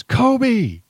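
high, loud, drawn-out shout; kid's yell. Retold or imitated. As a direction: yell it like a kid scoring a basket. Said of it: "the kid yells".

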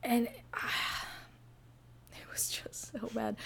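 voiceless, sighing disappointment; dismayed near-whisper. Real, not acted. Co-host despairs at the movie's poor job, near-whisper. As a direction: let the verdict sink to a whisper.

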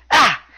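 short, high, loud yelp; one sudden punched "Ah!" in imitation; Tozawa's "Ah!" cry. Wrestler answers into the mic, short high imitated yelp. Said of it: "Tozawa simply says into the mic".

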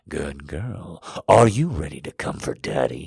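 low, coaxing male voice; cooing. A narrator's reading. Man to his waiting lover, low coo. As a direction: coo it low, starting soft. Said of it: "he coos".

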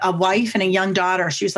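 usual speaking voice

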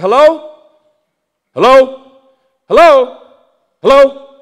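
loud, high repeated hello; calling into phone. Retold or imitated. Pastor answers an unknown number, calling out.

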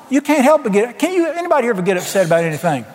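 ordinary talk

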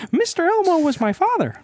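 high cartoon mouse voice; squeaky mouse impression; Mickey. Attempting Elmo but landing on Mickey, high voice. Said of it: "I'm just doing Mickey".

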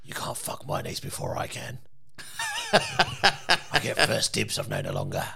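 very low, hushed Cockney menace; Cockney growl. Acted. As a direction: growl it softly in thick Cockney. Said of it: "getting more and more Cockney".

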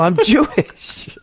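high, drawn-out joking shout; mock yell. Co-host heckling the story of her running out, mock yell. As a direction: call it out as a callback gag. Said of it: "Did he yell".

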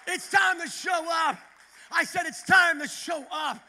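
loud, high, building to JESUS SHOWED UP; full revival shout; preaching at full force. Real, not acted. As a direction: preach it to the rafters, word by word. Climactic altar-call preaching.

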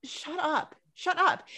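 mock irritated shut up; exasperated. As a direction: tell him off, fed up. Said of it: "where I'm just like".

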